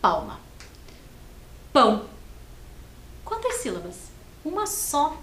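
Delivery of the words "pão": Pão said once, loud and clear for clapping; clear demo word. Shown as a demonstration. Teacher says pão so children clap its syllable. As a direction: say Pão as one clapped beat. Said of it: "Enquanto eu falo a palavra, você bate palma".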